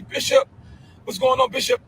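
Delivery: imitating club-goers calling out high; followers calling out to Bishop. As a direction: pipe up like a follower hailing the bishop. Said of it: "and they always say".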